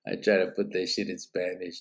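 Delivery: ordinary talk, nothing marked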